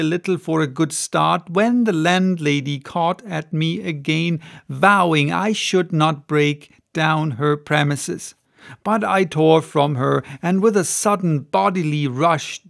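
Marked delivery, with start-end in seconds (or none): none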